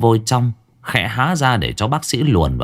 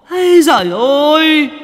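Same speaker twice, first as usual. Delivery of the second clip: high, loud crying shout; anguished wail; 6 dB louder than usual. A narrator's reading. The grieving mother cries out, loud, high and sobbing. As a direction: cry out in grief.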